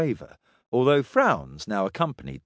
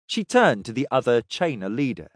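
usual speaking voice

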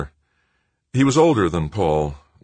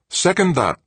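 calling out; 14 semitones higher, 4 dB louder than usual. A narrator's reading. Controller calls out a second.

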